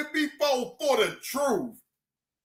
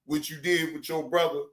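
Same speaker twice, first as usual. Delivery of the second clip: lower, teasing retort; mocking own reply; 11 semitones lower than usual. Answering Cam Lo mockingly. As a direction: tease him back low and dry. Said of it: "I say".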